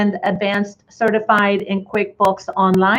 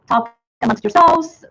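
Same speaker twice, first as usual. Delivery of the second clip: playful aside; 7 semitones higher than usual. Acted. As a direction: toss it off as a jokey quip to the crowd. Joking aside to webinar audience.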